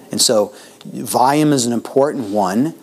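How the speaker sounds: usual speaking voice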